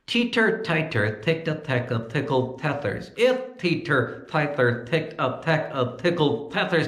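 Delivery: 「Teeter tighter」を唇を動かさずに言う; ventriloquist lips still. Shown as a demonstration. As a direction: lips frozen, T for P. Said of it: "I want you to do that tongue twister again".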